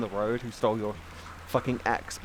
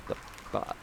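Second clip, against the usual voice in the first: breathy disbelieving whisper; a hushed, shaken aside; stunned whisper; 8 dB quieter than usual. Real, not acted. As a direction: mutter it under your breath in shock. Stunned reaction to robbery video.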